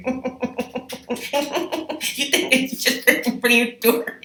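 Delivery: lightly voiced smug George teasing. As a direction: draw it out like a smug prankster. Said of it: "He's like".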